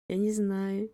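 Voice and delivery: ordinary delivery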